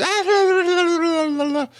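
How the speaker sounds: very high squealed babble, no words; mock falsetto "la-la-la" babble; mimicked put-on tone. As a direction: imitate a fake girly voice in nonsense syllables. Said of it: "put a tone in your voice".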